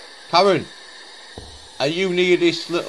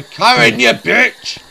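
taunting shout; 7 semitones higher, 8 dB louder, much slower than usual. Acted. Taunting ghost over radio.

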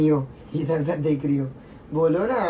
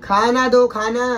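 loud, raised-pitch demand; demanding shout; 5 semitones higher, 6 dB louder, slower than usual. Real, not acted. Husband demands food from his wife, loud shout. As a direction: call out loudly for food.

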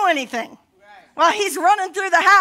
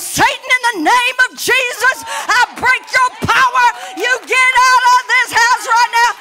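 loud, higher commanding rebuke of Satan; mock rebuking shout; 8 semitones higher, 7 dB louder than usual. Pastor imitating her husband rebuking Satan mid-argument, shouting. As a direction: boom it out like a husband casting out a demon. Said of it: "and goes".

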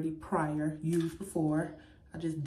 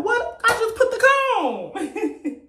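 high, loud, drawn-out mock wail; exasperated outburst; 7 dB louder, much slower than usual. Woman mimicking losing a comb while doing hair, exasperated outburst. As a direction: act out baffled frustration, breaking into laughter. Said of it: "you be like".

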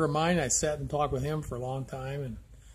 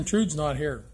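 ordinary talk

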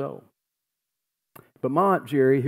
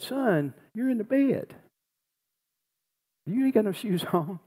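put-on higher, amused old father's voice, with a laugh; grandfather's reply; 9 semitones higher than usual. Grandpa answers his dying son at the bedside, higher and amused. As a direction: play the gentle old dad chuckling at his son.

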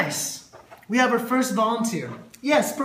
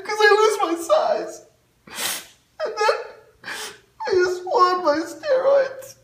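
high, sobbing breakdown; crying; 10 semitones higher, 5 dB louder than usual. Acted. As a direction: cry out for help.

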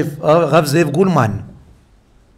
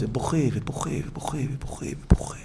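softer and softer until it is only breath; fading to a whisper; 2 semitones lower, 11 dB quieter than usual. A narrator's reading. Preacher telling of the rabbi's weeping, fading to a whisper. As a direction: let each word sink into a hush.

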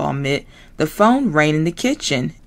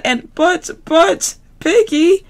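higher frog trying to cut in; protesting Kermit; 12 semitones higher, 4 dB louder than usual. Acted. Kermit trying to protest to Piggy, higher. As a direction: interrupt weakly as the frog. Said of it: "Kermit tried to say".

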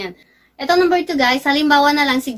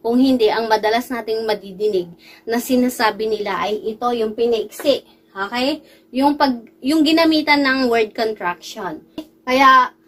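ordinary talk, nothing marked

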